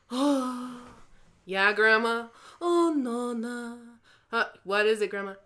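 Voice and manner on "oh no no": ordinary delivery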